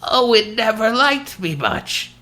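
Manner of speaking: put-on slow, high elderly woman's voice; old lady voice. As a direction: speak like a frail old widow. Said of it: "Did you like my old lady voice".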